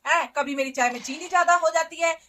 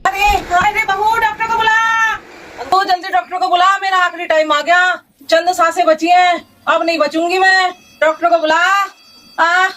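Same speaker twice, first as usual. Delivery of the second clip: fake dying; 3 semitones higher, 6 dB louder than usual. Mother-in-law fakes dying, calls doctor.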